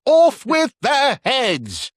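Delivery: high, slow shrieked royal command; mock Queen of Hearts. Host joking about beheading royals in a shrill mock queen voice. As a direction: screech it like a mad queen ordering beheadings. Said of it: "we cut their heads off".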